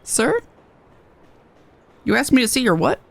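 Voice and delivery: ordinary talk, nothing marked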